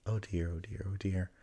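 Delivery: anxious whisper, oh dear; scared little whisper; whispered to himself. A narrator's reading. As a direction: breathe it out in a worried whisper. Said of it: "said Piglet to himself".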